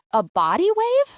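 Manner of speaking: A body wave? echoed in shocked disbelief; surprised. A narrator's reading. Husband echoes wife's hairdo in shocked disbelief.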